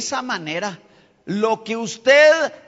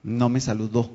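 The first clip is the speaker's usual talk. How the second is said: low, subdued self-talk; dropped pitch, understated hurt; acted private grievance; 8 semitones lower than usual. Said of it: "usa unas palabras y dice".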